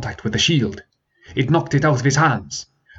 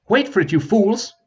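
higher, sharp order to hold; commanding prince; 11 semitones higher than usual. Acted. Prince holding back his fleeing men, commanding. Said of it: "he commanded".

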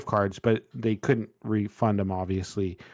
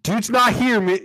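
lightly voiced celebrity call screener, pitched up; Barack Obama; 9 semitones higher, 3 dB louder than usual. Celebrity answering app turns away a caller, lightly imitated in a raised voice. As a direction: put on a higher voice to brush off the caller. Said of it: "Barack Obama answers the phone and he's like".

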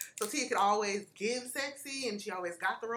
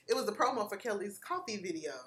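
ordinary delivery

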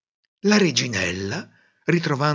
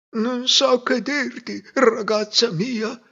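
kindly hermit; 11 semitones higher, slower than usual. A narrator's reading. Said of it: "disse l'eremita".

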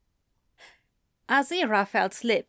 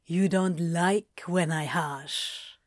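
low, slow, teasing smirk; 4 semitones lower, 5 dB quieter, slower than usual. A narrator's reading. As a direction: tease him softly and low. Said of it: "Crowley smirked".

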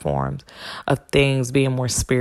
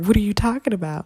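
mock-tearful, pitched higher and quick; hurt, higher-voiced mock protest; retold tearful protest; 7 semitones higher, faster than usual. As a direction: imitate yourself protesting through tears. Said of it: "I'm tearing up and shedding tears".

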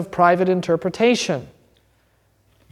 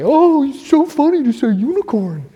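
mock sneering skeptic; 8 semitones higher, 4 dB louder than usual. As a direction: scoff like a smug critic, pitched up. Mocking the skeptic.

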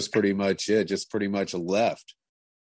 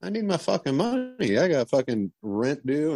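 higher-pitched imitation of an insistent bandmate; insistent retold demand; 8 semitones higher than usual. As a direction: retell his demand for pay in a raised pitch. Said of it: "he's like".

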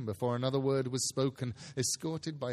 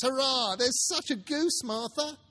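put-on much higher, loud cheering kids; excited children; 11 semitones higher, 5 dB louder than usual. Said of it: "cried the two young Cratchits".

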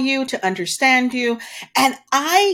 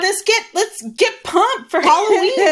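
pumped up; 5 semitones higher than usual. Acted. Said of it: "I'm fist pumping in the air".

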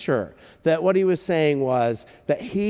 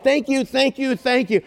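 higher, eager retold thanks; gushing thanks; 10 semitones higher than usual. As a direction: gush a string of thank-yous, pitched up. Retold grateful man thanking her.

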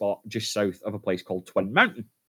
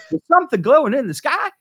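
high, loud squeaky wife voice; 8 dB louder than usual. Retold or imitated. Wife pointing husband to the sky, high squeaky voice. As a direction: pipe up like a startled housewife.

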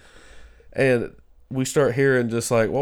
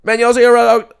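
higher, louder and fast, frantic; imitating a frantic woman asking about her dog; high-strung neighbour; 12 semitones higher, 12 dB louder than usual. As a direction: rattle it off like a wired stranger. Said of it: "she said it again".

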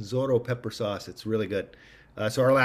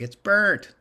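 higher, louder mock protest; imitated complaint; 9 semitones higher, 6 dB louder than usual. People complaining about the crispy rice, mock protest. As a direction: voice an alarmed diner objecting. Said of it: "People are like".

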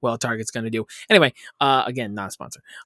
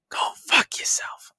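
joking whisper; 3 dB quieter than usual. Acted. Jokingly swears at co-host Dylan.

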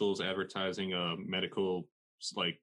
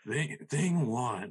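slow, drawn-out mock voiceover; an amateur's slow attempt at an announcer voice; mimicking announcer; 4 semitones higher, much slower than usual. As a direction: try to copy the announcer, very slowly. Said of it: "Wait, wait, let me".

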